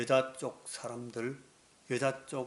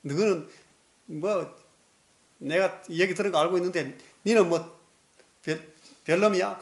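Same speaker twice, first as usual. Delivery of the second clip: high, loud scolding; woman swears at a man in the crowd, high and loud; cursing at them; 6 semitones higher, 7 dB louder than usual. Retold or imitated. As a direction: snap at someone she knows, raised and loud. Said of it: "욕을 하고 막 그랬겠어요".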